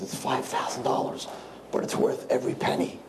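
breathy hushed whisper of a price; whispered reply; hushed Duke. Acted. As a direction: murmur it like a secret. Duke answers butler.

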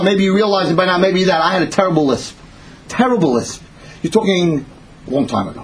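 ordinary talk

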